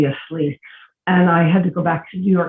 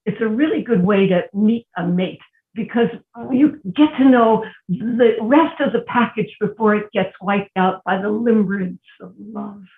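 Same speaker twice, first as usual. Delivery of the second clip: ordinary talk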